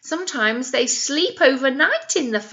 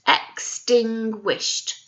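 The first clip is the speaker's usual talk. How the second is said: "Extinguished" drawn out syllable by syllable; slow syllable by syllable; much slower than usual. Shown as a demonstration. Teacher models "Extinguished" again for children, very slow. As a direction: stretch out "Extinguished" for them to repeat. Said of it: "Three syllables".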